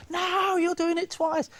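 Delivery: high, slow mock kids' shout; a whole house of children yelling at the stage; screaming kids. Panto audience of kids calling out the trick, high and slow. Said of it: "a house full of kids screaming".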